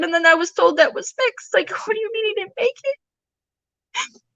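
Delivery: tearful disbelief. Real, not acted. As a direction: voice pushed high with real grief. Reliving learning her son died.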